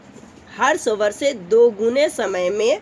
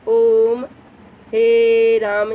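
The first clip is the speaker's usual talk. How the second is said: ओम and हे राम stretched long, louder, very slow; खींचकर पुकारना; drawn-out call; 7 dB louder, much slower than usual. Shown as a demonstration. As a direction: pull each word out long as if calling from far. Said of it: "ये थोड़ा सा long करके खींचते हैं".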